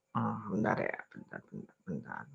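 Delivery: sleepy, drowsy mumbling; nodding off. Real, not acted. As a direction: mumble it half asleep and fading. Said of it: "saya kok ngantuk ini". (mengantuk, bergumam setengah tertidur)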